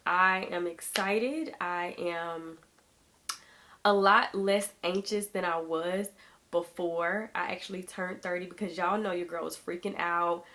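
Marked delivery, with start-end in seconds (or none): none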